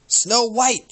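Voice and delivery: shrieked high and loud; angry shriek. Acted. Jealous queen shrieks the rival's name in rage. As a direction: scream the name in fury. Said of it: "shrieked the angry queen".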